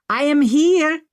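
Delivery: put-on high, loud hail in an Indian accent; calling out. A narrator's reading. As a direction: call across to be noticed. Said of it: "the man called".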